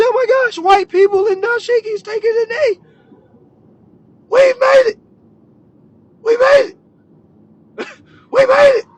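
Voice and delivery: very high, loud squeal; hammed-up naive celebration; mock elation. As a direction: squeal it like a gullible true believer. Mocking own past naive celebration.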